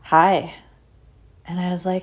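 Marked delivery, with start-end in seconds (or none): 0.0-0.5 curt, louder hostile greeting; imitated unwelcoming one-word greeting; judgmental hi. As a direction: say hi as if sizing up an outsider. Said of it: "in the exact same tone of voice".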